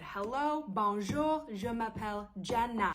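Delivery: chirpy introduction in French, name said the French way; polite French greeting. Retold or imitated. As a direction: greet the family in careful French.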